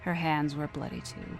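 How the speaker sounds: plain reading voice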